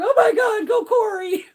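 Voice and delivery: high, loud cheer; root him on from the couch; cheering at the TV screen; excited cheering. Retold or imitated. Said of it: "at the fucking box going".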